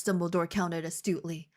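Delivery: usual speaking voice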